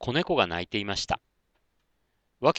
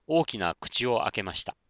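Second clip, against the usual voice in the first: ordinary delivery, nothing marked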